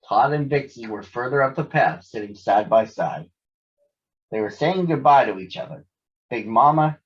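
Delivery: ordinary delivery, nothing marked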